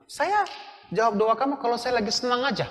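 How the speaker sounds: high, loud ironic God voice; preacher voicing God mockingly, high and loud; mock voice of God.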